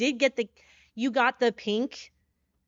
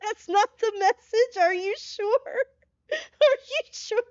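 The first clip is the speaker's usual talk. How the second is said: high shrieking laugh-cry; real helpless laughter; laughing hysterically; 12 semitones higher, 5 dB louder than usual. Real, not acted. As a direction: crack up in disbelief. Laugh-crying at raid message.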